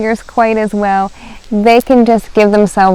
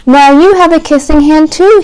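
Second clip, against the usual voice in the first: higher, proud little raccoon; proud child; 7 semitones higher, 7 dB louder than usual. Acted. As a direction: show off a clever idea. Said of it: "he told her".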